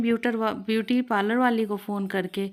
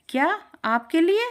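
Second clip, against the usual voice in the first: surprised; 8 semitones higher, 4 dB louder than usual. Acted. Shopkeeper astonished at old customer.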